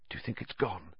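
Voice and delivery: very soft, cautious question; hushed and tentative; whispered. A narrator's reading. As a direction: whisper it, afraid of being heard. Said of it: "Harry whispered".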